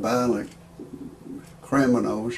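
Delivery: normal talking voice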